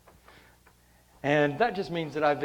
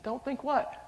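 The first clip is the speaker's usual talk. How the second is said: much higher, put-on innocent question; feigned innocence; 12 semitones higher than usual. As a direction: play dumb with a high, lifted voice. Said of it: "I put on the act".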